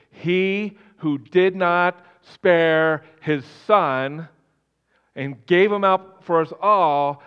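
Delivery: very slow, loud, weighty reading; slow, forceful verse reading; emphatic scripture reading. Acted. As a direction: read it out word by word, loudly.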